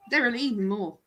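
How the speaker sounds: ordinary talk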